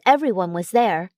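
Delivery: ordinary delivery, nothing marked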